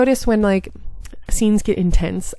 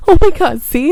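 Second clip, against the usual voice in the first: loud, high cry at her own slip; real loud self-mocking exclamation; exasperated outburst; 8 semitones higher, 11 dB louder than usual. Real, not acted. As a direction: blurt it out in disbelief at herself. Laughs at own misreading.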